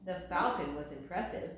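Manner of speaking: ordinary delivery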